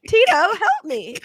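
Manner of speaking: high, loud, drawn-out cry for help; play-acted call for rescue; mock plea. Man with stuck hand begging Tito, mock plea.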